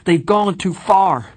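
high, loud, desperate thought; a boy held under water; panic. A narrator's reading. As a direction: gasp it out while drowning. Drowning panic in the fountain.

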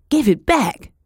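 high, loud shout; girl shouting at the thief who grabbed her bucket. Acted. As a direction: yell it and fight for the bucket.

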